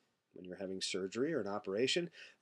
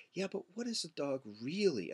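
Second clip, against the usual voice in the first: higher-pitched challenge, "really" emphasized; stress on REALLY; 5 semitones higher than usual. Acted. Philosopher challenging an everyday answer, stress on "really". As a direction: press the question, leaning on "really". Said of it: "put the emphasis on that word really".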